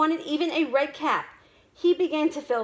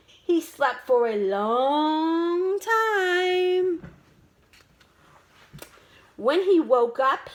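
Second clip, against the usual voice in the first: ordinary delivery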